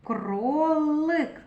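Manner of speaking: Кролик slowly, lingering on the л; drawn-out Л. Shown as a demonstration. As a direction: hold the л in Кролик to single it out.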